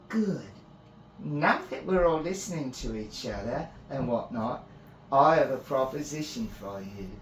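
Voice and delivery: high, loud, chirpy and pleased; cheery fae priestess. Acted. Priestess offering Finn a proposition, cheerful. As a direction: announce the offer with playful brightness.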